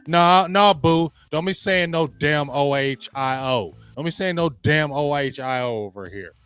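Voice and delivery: ranting. Real, not acted. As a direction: snap back, fired up. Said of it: "I don't care if I'm ranting".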